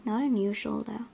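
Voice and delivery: usual speaking voice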